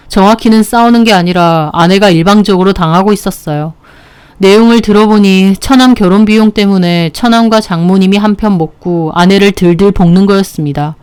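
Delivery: plain reading voice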